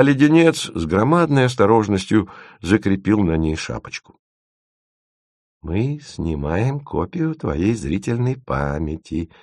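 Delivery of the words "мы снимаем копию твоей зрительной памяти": slow, softer show of enthusiasm; с энтузиазмом. A narrator's reading. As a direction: savour it slowly, with quiet eagerness. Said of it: "сказал он с энтузиазмом". (медленно, с энтузиазмом)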